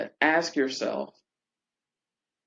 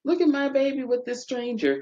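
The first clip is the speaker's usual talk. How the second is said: amazed parent; 10 semitones higher than usual. Retold or imitated. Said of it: "the parents just go".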